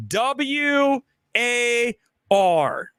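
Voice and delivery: each letter W, A, R drawn out slowly; spelled out slowly. Shown as a demonstration.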